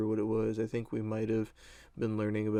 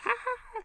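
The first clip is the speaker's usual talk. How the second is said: high shrieking laugh burst; wild laugh shriek. Retold or imitated. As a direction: let out a crazy high cackle. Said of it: "started laughing ridiculously".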